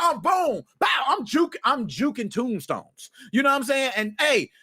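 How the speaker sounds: ordinary talk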